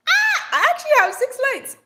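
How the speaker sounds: very high, loud, fast outburst; stunned by a silly comment; shocked squeal. Real, not acted. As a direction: shriek in disbelief.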